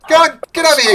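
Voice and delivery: loud, high barking boss dwarf; gruff dwarf shout. Acted. Dwarf foreman yells at the party to start fishing. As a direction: bellow orders at lazy workers.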